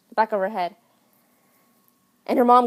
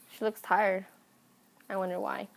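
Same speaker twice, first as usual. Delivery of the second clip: quiet aside; 9 dB quieter than usual. Retold or imitated. Maid's aside about Lizzie.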